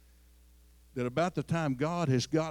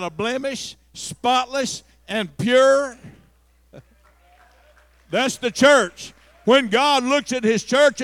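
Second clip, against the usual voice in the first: louder, higher preaching, building; preaching at full force; 8 semitones higher, 14 dB louder than usual. Acted. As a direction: drive it home to the congregation.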